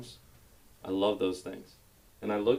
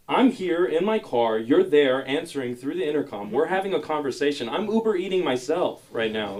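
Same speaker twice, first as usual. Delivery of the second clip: exasperated rant; 6 semitones higher, 4 dB louder than usual. Retold or imitated. Ranting at the intercom.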